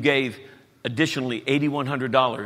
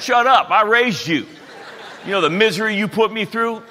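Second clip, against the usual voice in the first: higher and louder, a hammed-up fed-up father; laughing mock tirade; mock angry outburst; 9 semitones higher, 7 dB louder than usual. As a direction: snap back at the kids in playful outrage. Mock quarrel with his grown kids.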